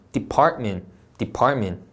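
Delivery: "department" as de-PART-men; no inserted vowel. Shown as a demonstration. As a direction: swallow the Ts in "department". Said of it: "이렇게, 좋아요". ("department"의 T 뒤에 모음 없이)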